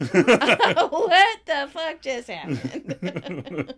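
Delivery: slow, loud drawn-out disbelief; stunned. Acted. Whole gang after Tommy's outburst, slow stunned amazement. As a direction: stretch every word in shock. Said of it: "The gang is stunned".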